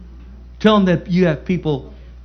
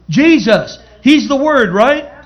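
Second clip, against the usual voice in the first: much higher and louder, preaching hard; fervent call-out; 7 semitones higher, 6 dB louder, slower than usual. Real, not acted. Preacher answering his own question to the saints, fervent. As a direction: shout the answer out to the church.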